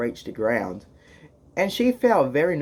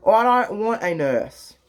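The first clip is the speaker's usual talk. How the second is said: higher, louder snappy refusal; raised voice; 6 semitones higher, 4 dB louder than usual. Acted. Snake snapping at the bears, a louder higher refusal. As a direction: snap back at the intruder.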